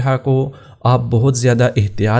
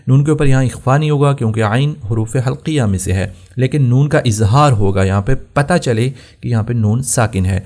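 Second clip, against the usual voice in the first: normal talking voice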